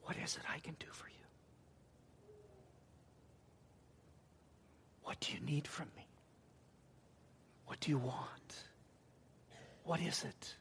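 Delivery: soft unvoiced whisper. Jesus whispering to blind Bartimaeus, hushed whisper. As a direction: whisper it tenderly into his ear. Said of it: "I'm even wondering if he whispered that".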